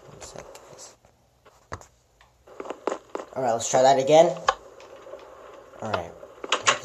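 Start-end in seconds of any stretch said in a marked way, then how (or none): 0.1-0.9 whispered aside to viewers. Real, not acted. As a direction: breathe it out, unvoiced. Steps away from the mic.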